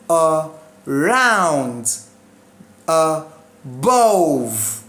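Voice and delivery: slow about, within, Between, around, above, each second syllable swelling then falling; spaced-out model of about, within, Between, around, above, stressed on the last syllable. Shown as a demonstration. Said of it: "exemptions preposition".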